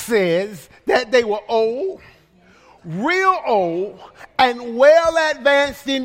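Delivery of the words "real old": usual speaking voice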